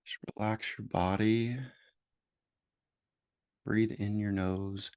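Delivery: slow, measured meditation voice with long pauses; guided meditation. Real, not acted. Host leading listeners into noticing their judgments, guided meditation voice. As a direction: guide gently, leaving space after each phrase.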